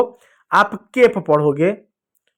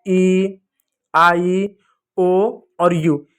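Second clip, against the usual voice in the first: slow listing; much slower than usual. Shown as a demonstration. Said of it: "vowel होता है आपका कितना होता है?".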